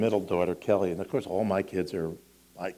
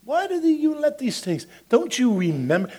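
pitched way up and loud; high, loud preaching; 5 dB louder than usual. Acted. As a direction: cry out at your own soul. Preacher voicing self-talk at peak.

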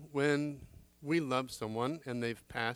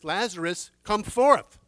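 high, loud summons; commanding call; 6 semitones higher, 11 dB louder, slower than usual. Acted. Student quoting Jesus, booming loud command. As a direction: call the dead man out.

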